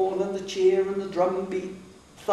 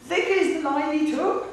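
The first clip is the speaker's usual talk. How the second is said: huntsman's call, higher and a bit louder; a huntsman calling to his hounds across the brook; 11 semitones higher, 3 dB louder than usual. A narrator's reading. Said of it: "a man's voice".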